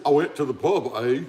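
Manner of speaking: lightly put-on Canadian with a tagged-on "eh"; a hint of pretend Canadian; mock Canadian accent. Shown as a demonstration. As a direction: do a casual, understated Canadian accent. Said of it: "When he's trying to be Canadian".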